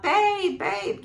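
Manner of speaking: mock call to a partner, high then dropping; imitated calling out. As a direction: imitate calling with a hand over the mouth. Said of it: "put her hand over her mouth".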